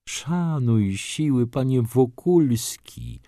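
put-on pompous elderly father figure, drawn out; solemn warning. A narrator's reading. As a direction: warn him with mock solemnity, slowly. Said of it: "ostrzegł go pan Tomasz, uroczyście grożąc palcem".

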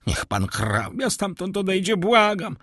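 high, pleading cry; frightened plea. A narrator's reading. Terrified steward begs the count, high pleading cry. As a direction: beg him away in fear. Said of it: "zawołał".

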